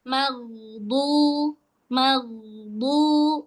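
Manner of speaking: slow maghdu with a lingering gh; held ghain in maghdu. Shown as a demonstration. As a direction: hold the gh until du sounds. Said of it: "tahan nih sampai bunyi du".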